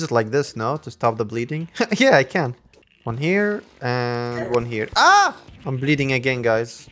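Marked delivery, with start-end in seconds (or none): 4.9-5.4 short, high, loud "ah"; startled yelp. Real, not acted. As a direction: yelp as the hit lands. Hurt in the game.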